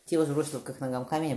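ordinary delivery, nothing marked

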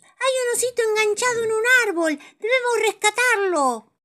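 high, loud excited little voice; urgent cartoon kid voice; Paco's voice. Paco telling friends a bear is stuck, urgent and high.

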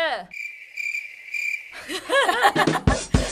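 high giggling fit; burst of laughter. Real, not acted. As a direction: laugh through the flubbed intro. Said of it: "เอาใหม่ดิ". (หัวเราะลั่น)

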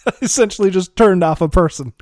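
real laugh through the words. Real, not acted. Player laughing at having shut down an NPC.

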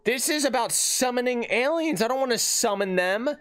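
put-on high-pitched childish complaint; whiny protest. Podcaster objecting to alien contact protocols, whiny protest. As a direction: whine in mock dismay.

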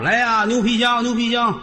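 street hawker's cry. Acted. Vendor hawking leather suitcases to passers-by, high sing-out cry. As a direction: stretch it out high like a peddler.

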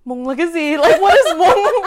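high, laughing mock bafflement; baffled. Customer asking waiter about an unknown word, hammed-up bafflement. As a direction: act out total confusion, pitch climbing. Retelling confused spoon exchange.

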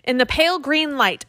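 ordinary delivery, nothing marked

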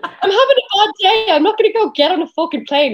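high-pitched, indignant mock rant; comic venting about a bad day; exasperated retort. Acted retort to friends' advice.

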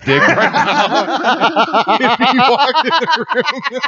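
slow, laughing disbelief; laughing tease. Real, not acted. Friend laughing while teasing about [name]. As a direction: drag it out through a laugh.

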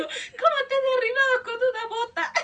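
high voice breaking into laughter; imitated laughing disbelief. Mom laughing after her boot throw, high laughing disbelief. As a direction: squeal it through the laughs as mock disbelief. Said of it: "se empezó a reír".

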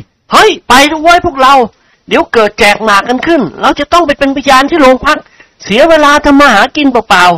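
rope the gang in with a grin; joking young man. Acted. Nikorn jokes to his friends in a high, playful voice.

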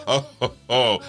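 demonstrating a belly laugh. Shown as a demonstration. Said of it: "We say a ho ho from the stomach".

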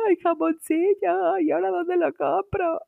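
hammed-up wail over the candy; mock crying. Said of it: "yo lloraba".

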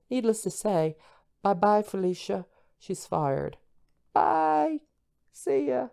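high and loud, snappy bye-bye; campy bye-bye Felicia send-off; sassy dismissal. Acted. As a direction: dismiss her with sass.

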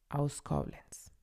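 ordinary talk

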